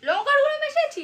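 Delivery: angry accusation. Real, not acted. Husband suspects chilli powder in his tea, angry accusation. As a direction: fire it out as an accusing shout.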